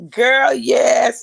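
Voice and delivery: bursting with emotion; real joy breaking through tears; tearful joyful shout. Real, not acted. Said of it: "overwhelmed joy, crying out".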